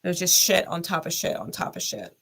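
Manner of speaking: usual speaking voice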